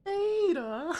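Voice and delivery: very high Australian cartoon wife; squealed Australian impression of a wife; Lois. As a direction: stretch it out high in an Australian accent. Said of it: "Australian Lois".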